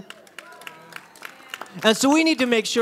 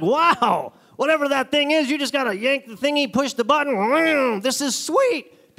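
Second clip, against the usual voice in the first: higher, amazed newcomer; awestruck; 6 semitones higher than usual. Retold or imitated. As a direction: gush over the new toy. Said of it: "you're gonna be like".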